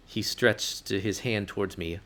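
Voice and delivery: usual speaking voice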